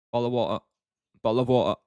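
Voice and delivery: everyday British accent, casually said. Shown as a demonstration. Streamer repeating a test phrase for chat, British accent. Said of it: "with British accent".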